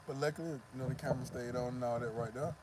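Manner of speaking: ordinary delivery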